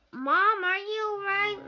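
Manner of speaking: high, loud worried call. A narrator's reading. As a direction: call out in alarm. Worried call to screaming mom.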